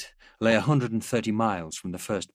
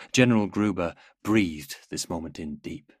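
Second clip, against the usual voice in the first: usual speaking voice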